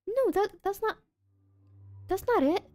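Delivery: frustrated. Real, not acted. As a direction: panic that it's wrong. Frustrated at game.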